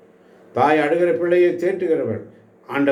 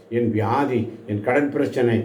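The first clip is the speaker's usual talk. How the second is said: usual speaking voice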